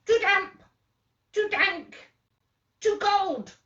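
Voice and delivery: very high squeaky little mouse. Acted. Mouse complains about the cave in a high squeak. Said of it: "The mouse squeaks".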